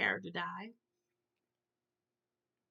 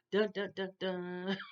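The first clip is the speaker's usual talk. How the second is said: sung dun dun dun like a TV cliffhanger cue; dramatic sting; slower than usual. Pretend. Vlogger mocking the cheesy threat with a dramatic cue. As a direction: hum the ominous soundtrack hit.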